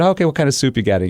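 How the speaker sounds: light teasing sarcasm; sarcastic. Retold or imitated. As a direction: play along to trap him. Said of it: "sarcastic line of questioning coming his way".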